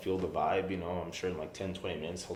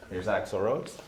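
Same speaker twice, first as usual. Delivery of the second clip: normal talking voice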